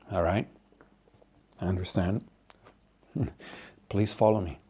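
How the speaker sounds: soft, low-key, spaced-out phrases; discreet doctor. Acted. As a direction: quietly accept and lead them away. Patient doctor agrees, leads them away.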